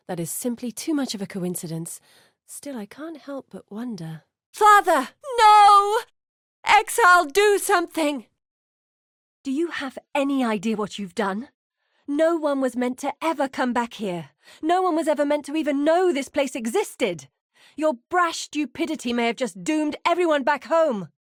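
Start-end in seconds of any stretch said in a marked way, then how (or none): 4.5-8.3 screaming high and very loud in horror; begging for help as her father dies; desperate shriek; anguished cry. Acted. Witnessing father's death, crying out. 9.4-21.1 higher, louder reproach; scolding the exile for a reckless blunder; stern accusation; furious rebuke. Acted.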